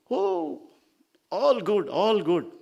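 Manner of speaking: high, loud, bouncy assurance; cheery reply. Acted. Sage assuring Narada about his sadhana, high and cheery. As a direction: answer brightly, pitched well up.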